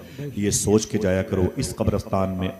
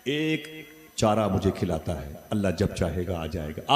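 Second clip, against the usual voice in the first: usual speaking voice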